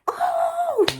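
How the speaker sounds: long, high, loud "ohhh"; high gloating "ooh". Retold or imitated. Reacting to a phone call from county jail, high loud whoop. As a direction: sing out a delighted, drawn-out whoop.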